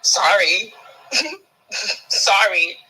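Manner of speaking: a laughing, mock sorry; laughing apology. Real, not acted. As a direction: say sorry while cracking up. Laughs while apologizing mockingly.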